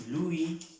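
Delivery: "Louis" said "lu-í", with no final S; S final muda. Shown as a demonstration. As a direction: drop the S and end "Louis" on a clear i. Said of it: "esa S no se pronuncia". ("Louis" a la francesa, "luí", sin S)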